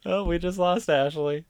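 laughing until crying; can't stop laughing; laughing hard. Real, not acted. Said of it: "She's legit crying".

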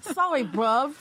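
a hint of London street slang; apologizing to British Martha in mock London slang; street British accent. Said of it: "you took more of a street accent".

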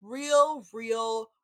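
slow, drawn-out talking-head impression. Retelling his talking head, slow mock-dramatic imitation. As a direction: stretch each word out slowly, mock-dramatic. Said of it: "In his talking head, he's like".

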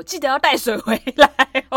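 calling after her. Retold or imitated. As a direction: call it across the room as she leaves. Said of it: "然后我顺便叫Pin说".

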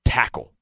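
"tackle" set apart as one weighty word; emphatic TACKLE. Real, not acted. Analyst naming his key to the game, emphatic "tackle". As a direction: land firmly on "tackle" by itself. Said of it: "I can't emphasize this word enough".

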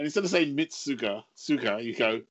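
ordinary talk, nothing marked

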